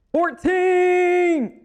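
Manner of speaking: high, loud cry of disbelief; shocked shout. Real, not acted. Player seeing the approval jump, shocked shout. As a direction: yell it out, stretched and high.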